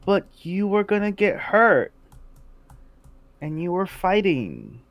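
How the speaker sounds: hesitant, tearful-sounding protest; halting, as if close to crying; on the verge of tears. Acted. Kareev worried his friend would get hurt, near tears. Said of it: "on the verge of tears".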